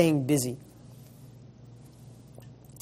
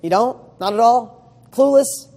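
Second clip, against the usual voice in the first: higher, louder, teasing incredulous questions; mock disbelief; 6 semitones higher, 7 dB louder than usual. Speaker challenging his own excuse, teasingly incredulous and louder. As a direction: challenge the excuse with playful doubt. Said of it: "Oh, I don't know how to fix this".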